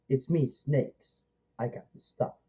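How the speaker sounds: lightly whispered gangster line from the film; hushed movie line. Retold or imitated. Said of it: "the one he says".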